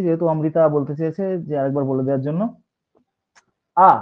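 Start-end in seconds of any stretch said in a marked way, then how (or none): 3.8-3.9 "আ" as an open, short uh; article pronounced uh. Shown as a demonstration. Teacher showing students the article's sound, said as uh. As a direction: say "আ" like the sound spelt uh. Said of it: "এর উচ্চারণ হচ্ছে ইউএইচ".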